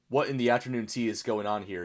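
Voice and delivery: ordinary delivery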